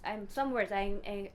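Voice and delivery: normal talking voice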